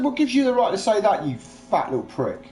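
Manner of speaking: high, loud outrage easing onto the insult; retold indignant challenge. Retelling row with the stepdad over his daughter, indignant challenge.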